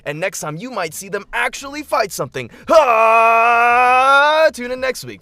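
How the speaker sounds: high, loud trailer announcer; promo voice. Pretend. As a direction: hype it like a TV promo. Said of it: "Promo voice, uh, no longer in activation".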